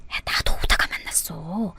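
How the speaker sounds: hushed whisper to a friend; whispered aside to a friend about the foreigner. Acted. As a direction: whisper it behind his back. Hushed reply so foreigner won't hear.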